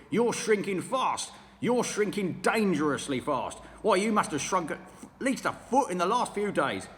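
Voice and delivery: sneering husband, loud; mean old husband taunting his wife; Mr Twit. Acted. Mr Twit's dialogue.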